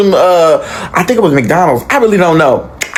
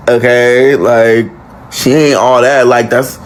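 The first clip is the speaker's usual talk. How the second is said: unimpressed; 8 semitones lower, slower than usual. Retold or imitated. Only one unimpressed by star's entrance.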